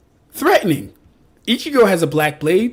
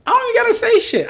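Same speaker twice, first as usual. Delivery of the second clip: squeaky high brag; faster than usual. Real, not acted. Repeats brag much higher.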